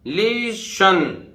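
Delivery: लिसन said very slowly, no t, like li-shan; silent t. Shown as a demonstration. Teacher modelling for students: slow लिसन, no t. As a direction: draw out लिसन with the t dropped. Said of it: "तो टी नहीं बोलेगा".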